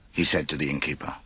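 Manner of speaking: plain reading voice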